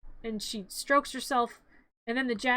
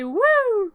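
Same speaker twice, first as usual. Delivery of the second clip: high, loud "woo"; playful high-pitched whoop; mock flying whoop; 9 semitones higher, 9 dB louder than usual. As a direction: whoop as if yanked skyward. Imitates Santa pulled into the sky.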